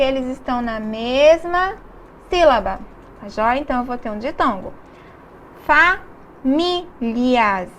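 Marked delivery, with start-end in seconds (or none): 6.4-7.7 Famílias stretched out very slowly; slow model word. Shown as a demonstration. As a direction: draw out Famílias, syllable by syllable. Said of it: "Nós temos aqui um ditongo". (Famílias dita bem devagar)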